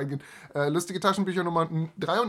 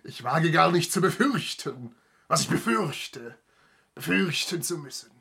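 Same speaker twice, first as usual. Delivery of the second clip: dramatic Hamlet-style declaiming; hammy stage actor. Acted. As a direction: recite like a Shakespeare actor.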